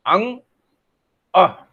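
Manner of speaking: अंग with a ringing nasal ng, अह with a breathy h; nasal sounds demo. Shown as a demonstration. Teacher demonstrating अंग and अह to the camera. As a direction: show the nose moving on अंग. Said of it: "आप देखिए, मैंने बोला".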